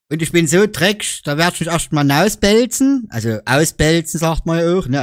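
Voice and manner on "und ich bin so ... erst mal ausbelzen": normal talking voice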